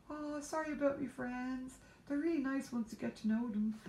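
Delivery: squeaky polite cookie apology; apologetic gingerbread man. Pretend. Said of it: "And then he's".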